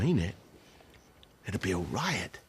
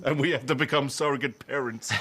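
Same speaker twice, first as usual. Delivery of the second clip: laughing; 8 semitones higher than usual. Acted. Laughs pitching the idea.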